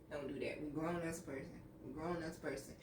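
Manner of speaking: low, very soft murmur; barely voiced reminder to behave; hushed self-correction. Real, not acted. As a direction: scold yourself gently under the breath. Talking herself down after teasing.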